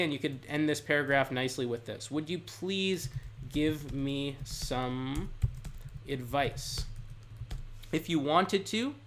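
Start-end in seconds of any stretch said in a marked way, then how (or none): 2.1-6.8 very slow, phrase by phrase with pauses; slow dictation. Shown as a demonstration. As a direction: lay out "Would you please give me some advice?" word by word, slowly and carefully. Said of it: "now here's what you could say".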